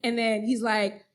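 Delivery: normal talking voice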